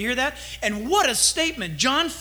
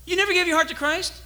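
higher and laughing, incredulous; amazed disbelief; 7 semitones higher than usual. Retold or imitated. Pastor retelling his reply to the kid, excited disbelief. Retold amazed reaction to Robert.

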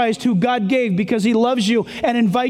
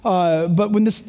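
ordinary talk

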